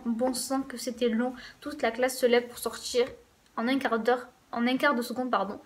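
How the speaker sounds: ordinary delivery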